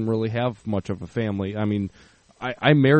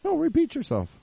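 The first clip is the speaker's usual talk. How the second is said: mock insistence. Teasing cohost to keep talking.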